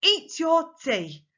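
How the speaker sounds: slow, high, loud parental order; bossy command. Shown as a demonstration. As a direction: order a child to eat, slowly and firmly. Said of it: "changed my voice for those, for those commands".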